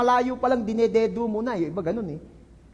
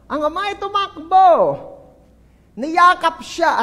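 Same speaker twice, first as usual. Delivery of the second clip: emphatic; 6 semitones higher, 7 dB louder than usual. Shown as a demonstration. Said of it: "Pero nagpapatawad ay ito".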